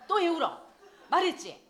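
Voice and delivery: put-on loud, high scolding of a child; angry scolding. Parent yelling at the crying child, loud scolding. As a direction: yell at the child for crying again.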